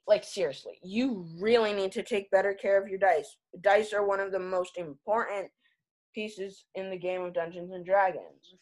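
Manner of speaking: ordinary talk, nothing marked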